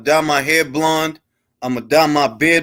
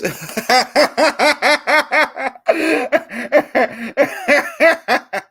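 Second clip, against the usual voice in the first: real laughter; 7 semitones higher than usual. Real, not acted. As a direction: crack up at the idea. Laughs at Uggs claim.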